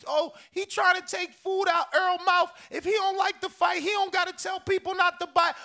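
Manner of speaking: high-pitched imitation of an aggrieved fan; mock threatening YouTuber. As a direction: mimic his complaint, pitch well up. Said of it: "threatening me".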